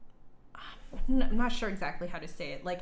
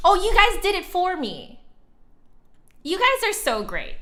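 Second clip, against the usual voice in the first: much higher and louder, bursting with delight; delighted thanks; 12 semitones higher, 10 dB louder than usual. Real, not acted. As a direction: gush happily at the chat. Thanking chat for posting link.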